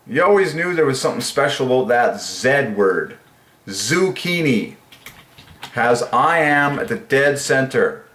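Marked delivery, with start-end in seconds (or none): 3.7-4.8 slow. Real, not acted. Savouring the word.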